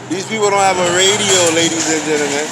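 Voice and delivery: forceful public statement; emphatic declaration. Real, not acted. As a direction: proclaim it to the whole room. Emphatic point to audience.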